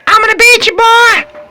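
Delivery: high, loud playful taunt; mock threat shout. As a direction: yell it like a cartoon villain.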